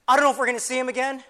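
worked-up, rushed urging; urgent resolve. Acted. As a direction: tell your friend it's now or never, fast. Urgent plan told to blind friend.

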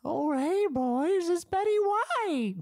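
put-on high, slow old lady voice. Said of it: "I think she just said".

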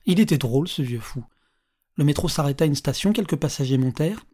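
ordinary delivery, nothing marked

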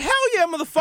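high, excited mock yell; hyped yell. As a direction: cheer it like free stuff arrived. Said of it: "I'm like".

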